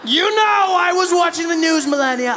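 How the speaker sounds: high, loud, laughing Trump voice; Trump impression. Comedian mocking Trump, brash loud Trump impression.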